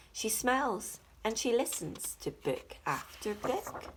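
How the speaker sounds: ordinary delivery, nothing marked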